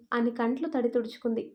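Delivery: usual speaking voice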